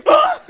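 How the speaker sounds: sharp shriek of surprise; high startled scream. Acted. Sudden fright.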